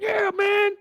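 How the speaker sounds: high, slow squeaky imitation; high pleading voice mocking Derek; mock whiny man. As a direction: drag it out in a high whine. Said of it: "This man on the phone talking about".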